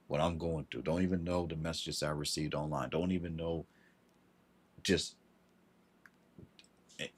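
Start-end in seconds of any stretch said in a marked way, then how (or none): none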